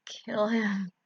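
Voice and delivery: hushed, breathy whisper; sinister whispered urging. Acted. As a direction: whisper the order coldly. Fake mother urges murder.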